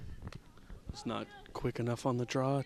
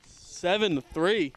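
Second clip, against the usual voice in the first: loud, high, slow score call; excited score call; 6 semitones higher, 6 dB louder, slower than usual. Real, not acted. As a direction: stretch out the score proudly. Calling the new score.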